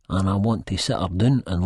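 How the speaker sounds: plain reading voice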